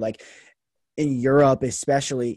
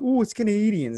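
put-on quick, higher Canadian accent jab; mock Canadian accent; 8 semitones higher than usual. Poking fun at how Canadians talk, light Canadian accent. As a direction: toss off a teasing Canadian accent. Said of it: "that's how they talk".

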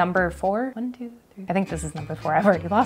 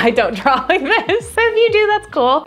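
laughing; 9 semitones higher, 5 dB louder than usual. Real, not acted. Laughing at her own silly drawing.

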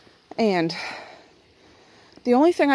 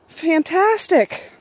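drawn-out delight; 8 semitones higher, 4 dB louder, much slower than usual. Real, not acted. Delighted at chores done.

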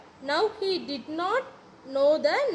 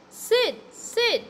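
higher-pitched call of the name; calling to wake; 8 semitones higher than usual. A narrator's reading. Tom calls to his sleeping brother, calling to wake.

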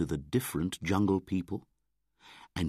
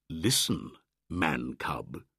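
ordinary delivery

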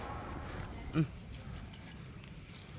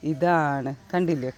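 normal talking voice

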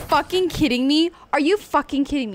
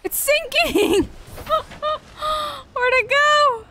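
alarmed cry; 14 semitones higher than usual. Real, not acted. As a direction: panic as it sinks. Panic as vehicle sinks.

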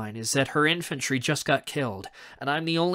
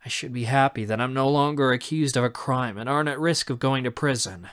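plain reading voice

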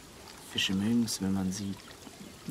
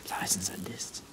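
hushed playful whisper; 4 semitones lower, 10 dB quieter than usual. Acted. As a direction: drop to a whisper. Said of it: "Warum flüsterst du?".

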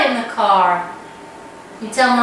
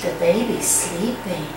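put-on low, soft voice by a sleeping baby; gentle lowered bedtime tone; hushed; 7 semitones lower, 7 dB quieter than usual. Hushed talk about a sleeping baby.

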